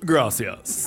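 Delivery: pompous gringo. Retold or imitated. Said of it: "and then he goes".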